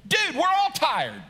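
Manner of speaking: high, exasperated comeback; not nice. Acted. Kirk answering the preacher's complaint of tiredness, exasperated. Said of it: "even if he says it nicely".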